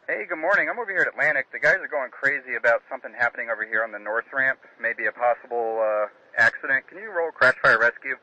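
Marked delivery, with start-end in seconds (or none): 0.1-7.1 thin radio voice over a headset; cockpit headset. Real, not acted. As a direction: talk through a cockpit mic. Pilot calling tower by radio.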